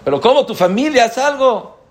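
high, loud, fast pleading; urgent plea. Retold or imitated. Retold friend urging him to do something, frantic high plea. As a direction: push him hard to act, voice up high.

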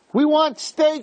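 high-pitched acted demand; demanding complaint. The people complaining about their food, demanding. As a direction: voice a mock clamouring complaint, high.

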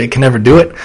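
ordinary talk, nothing marked

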